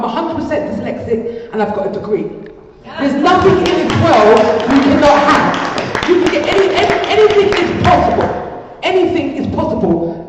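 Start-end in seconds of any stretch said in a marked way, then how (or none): 3.0-10.2 louder and higher, building to a ringing cry; rising passionate declaration. Real, not acted. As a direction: proclaim it like a rallying call. Repeats the motto with full conviction.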